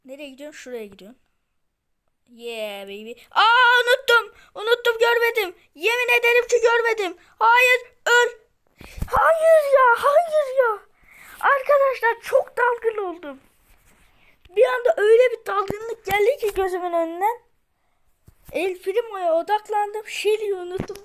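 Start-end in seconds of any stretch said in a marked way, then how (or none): 3.3-10.8 high, loud, whining panic; desperate crying protest; panicked wail. Real, not acted. 11.4-21.0 whiny. Real, not acted. Whiny excuse after dying.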